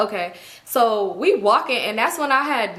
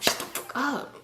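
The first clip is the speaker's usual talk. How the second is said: whispered telling-off, imitated; hissed hush; 4 semitones higher, 8 dB quieter, slower than usual. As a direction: shush them sharply under your breath. Friend secretly silencing the group.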